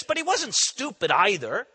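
normal talking voice

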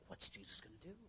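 breathless hushed whisper of a nervous disciple; tense whisper. Retold or imitated. A disciple whispers to the others in tense suspense. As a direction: whisper it, scared of what comes next. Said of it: "They're like".

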